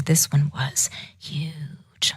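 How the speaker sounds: whispered, drawn out on the last words; storyteller's hushed aside. A narrator's reading. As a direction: lean in and whisper it.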